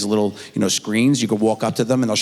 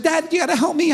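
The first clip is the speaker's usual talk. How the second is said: very high imitation of a panicked child; mock freaking out. Daughter begging her dad for help, imitated panic. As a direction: plead like a frantic little girl. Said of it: "she's freaking out".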